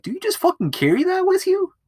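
very high-pitched comic disbelief; mock incredulous question. Viewer questioning her eight ball, high mock disbelief. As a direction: squeak the question as if baffled. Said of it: "I'm just thinking, I'm like".

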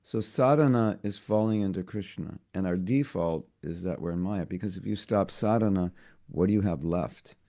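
normal talking voice

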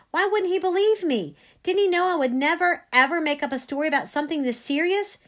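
hurt, agitated questions; distressed disbelief. A narrator's reading. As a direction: plead with nobody listening. Panicked girl disbelieved by the priest.

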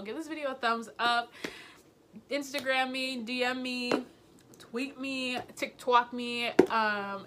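loud, eager outro call-out; excited. Real, not acted. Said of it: "I get so excited".

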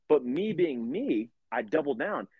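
normal talking voice

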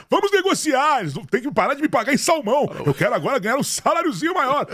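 high cartoon mascot voice demanding a raise; hammed-up demand, high and bright. Jokingly speaking as the bear.

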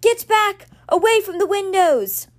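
loud, high order to get back; urgent warning. A narrator's reading. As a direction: push everyone away from the glass. Florence warns everyone to retreat.

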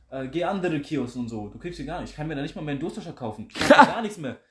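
usual speaking voice

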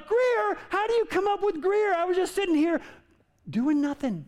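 high indignant protest; indignant kid. Retold or imitated. Wronged junior high boy protesting to the teacher, high and indignant. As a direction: squeak out your innocence like a wronged kid.

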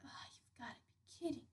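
fast, stunned whisper. Retold or imitated. Kid hearing mom's news, in a fast whisper. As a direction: breathe it in disbelief.

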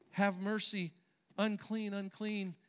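normal talking voice

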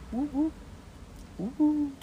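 high siren woo; siren imitation. Imitating a tornado warning siren.

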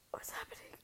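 hushed, confused brother voice; imitating brother. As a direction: whisper it like a puzzled little brother. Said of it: "he's like".

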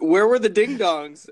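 loud, high indignant question; indignant outburst. Real, not acted. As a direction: demand it in mock outrage. Friend protests missing ding dongs.

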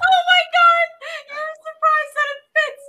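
high, loud laughing shout; laughing shriek. Real, not acted. Shrieking over the absurd scene.

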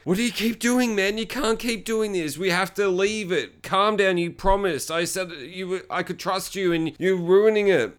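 high pleading telling-off; imitated exasperated woman. She scolds him in retold quarrel.

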